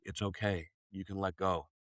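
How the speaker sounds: put-on soft and low, a mother rocking her baby; hushed tender comfort spoken as a loving spirit; soothing motherly voice. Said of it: "this warm, smooth, slow, relaxing, loving presence".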